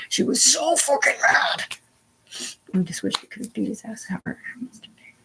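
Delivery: ordinary talk, nothing marked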